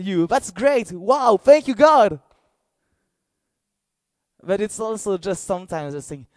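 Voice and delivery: high, loud, fervent preaching; impassioned preaching. Acted. Preacher assures the church God means no harm, preaching fervently. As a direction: preach it with full fire.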